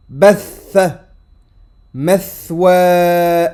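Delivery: each Arabic word slow and loud, clearly articulated; model reading to repeat. Shown as a demonstration. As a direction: read each word out for learners to copy. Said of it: "n'hésitez pas à mettre pause, à lire avant que je prononce".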